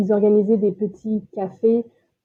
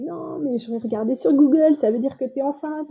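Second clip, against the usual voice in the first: higher-pitched mock amazement; retold excited husband; 4 semitones higher than usual. Wife imitates husband learning of the pregnancy, excited and higher. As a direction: imitate someone jumping for joy at news. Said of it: "mon mari a sauté, euh, au plafond".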